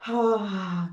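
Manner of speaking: long, open exhaled "ah"; audible sigh. Shown as a demonstration. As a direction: let the breath out in a relaxed sigh. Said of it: "Sigh it out".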